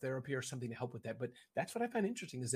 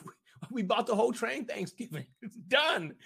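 higher, louder, laughing proclamation; joyful announcement; 7 semitones higher, 5 dB louder than usual. Pretend. Guest imagines telling train riders Thanksgiving is paid, gleeful. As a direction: announce the gift with a happy laugh.

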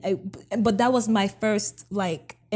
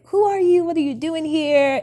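high, loud alarmed demand to a stranger; a frightened child challenging an intruder; startled question; 7 semitones higher, 5 dB louder, faster than usual. Retold or imitated. Little girl startled by a stranger in the bedroom, high and loud.

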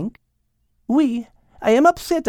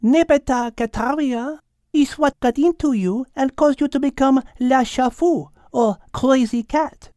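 almost matter-of-fact; 10 semitones higher than usual. A narrator's reading. Mademoiselle explains catnip to Al, almost matter-of-fact. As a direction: explain it evenly in a French accent. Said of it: "in almost a matter-of-fact tone".

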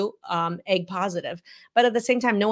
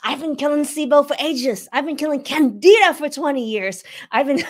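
higher, louder mock complaint from weary patients; mimicking frustrated patients; 6 semitones higher, 6 dB louder than usual. Host mimics patients who keep killing gut bugs, higher and louder. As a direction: whine it like a fed-up patient stuck on the same fight. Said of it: "How many of you out there are like".

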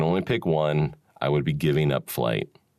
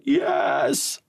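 high joyful shout; excited cheer. Retold or imitated. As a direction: cheer like the whole group just won. Everyone cheering at the find.

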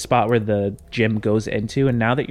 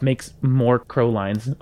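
ordinary talk

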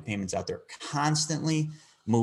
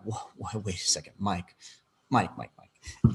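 much softer stunned whoa, slowing on the names; stunned halt; 2 semitones lower, 4 dB quieter than usual. Retold or imitated. Stopping Mike mid-pitch, stunned and hushed. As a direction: pull Mike up short, hushed and slow. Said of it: "and I'm like".

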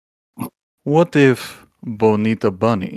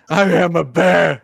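higher and louder, outraged bear; indignant shout; 8 semitones higher, 6 dB louder than usual. Acted. The panda, knife in hand, shouts at Pete in outrage. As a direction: insist on it, offended.

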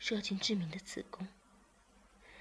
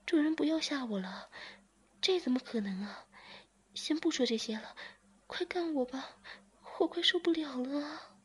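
pleading woman; 7 semitones higher than usual. A narrator's reading. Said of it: "便说".

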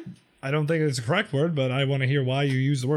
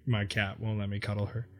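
ordinary delivery

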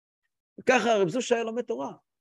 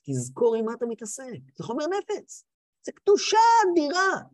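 fervent warning; 5 semitones higher than usual. Real, not acted. Preacher warns students of the holiness they handle, fervent high cry.

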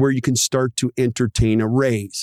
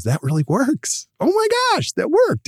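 squealing high with delight, much higher; a thrilled front-desk worker gushing over her win; excited Susie. Retold or imitated. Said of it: "Susie comes back to you and goes".

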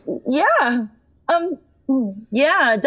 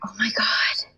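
shocked whisper; 2 semitones lower, 4 dB quieter than usual. Real, not acted. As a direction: breathe it under your breath. Caught out on speakerphone.

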